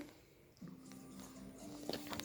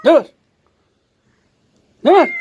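normal talking voice

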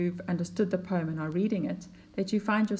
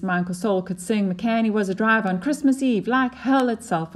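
reading the verse aloud, a little louder and more lilting; poem reading; 3 semitones higher, 6 dB louder than usual. Acted. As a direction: read the poem to the class with lift. Teacher reads the poem aloud.

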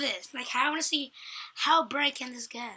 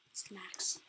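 whispering; 4 semitones lower, 12 dB quieter than usual. Real, not acted. Quiet aside.

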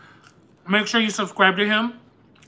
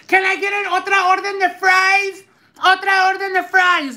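much higher put-on voice of the cashier calling the order; mimicking the worker; 9 semitones higher than usual. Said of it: "And she's like".

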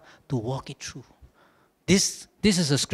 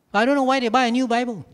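higher, louder, fast bafflement; mock exasperation; 8 semitones higher, 5 dB louder, faster than usual. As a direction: throw up your hands in disbelief. Exasperated rhetorical repeat.

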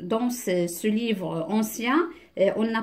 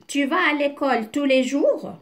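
raised pitch on "tu vas à l'école tous les jours?"; question intonation; 5 semitones higher, 4 dB louder than usual. Shown as a demonstration. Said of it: "C'est une question là aussi".

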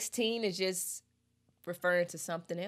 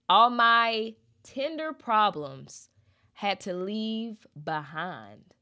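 slow lyric reading; 3 semitones higher, 7 dB louder, slower than usual. Acted.

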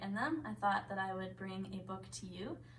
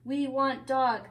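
loud, slow, higher-pitched demand; bellowing trolls; 5 semitones higher, 11 dB louder, slower than usual. A narrator's reading. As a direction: bellow it slowly like greedy trolls. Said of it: "they bellowed".

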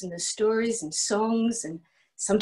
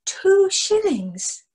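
higher, slow amazed echo of the price; astonished; 10 semitones higher, much slower than usual. Acted. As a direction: stretch it out in disbelief.

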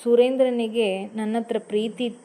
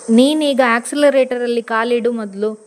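louder, firm order from the passenger seat; husband loses patience, louder command; voice raised a little; 8 dB louder than usual. Acted. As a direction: raise your voice to make her start. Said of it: "ಸ್ವಲ್ಪ ಧ್ವನಿ ಏರಿಸಿ ಹೇಳಿದ".

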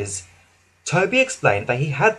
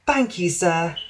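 humble thanks; 3 semitones higher, slower than usual. Acted. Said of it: "said Daisy humbly".

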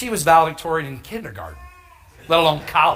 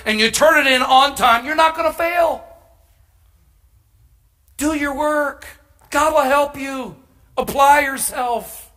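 preaching exhortation; 8 semitones higher, 3 dB louder than usual. Real, not acted.

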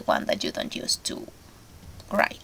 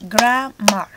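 GRAM-mar split into two beats; grammar, two beats; syllables shown; 7 dB louder than usual. Shown as a demonstration. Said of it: "Grammar is two syllables".

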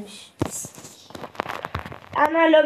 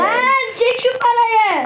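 excited yell; 8 semitones higher than usual. Real, not acted. Excited shout at game.

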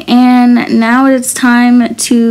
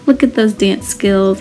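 normal talking voice

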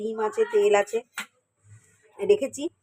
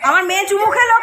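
ordinary delivery

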